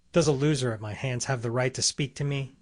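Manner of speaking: usual speaking voice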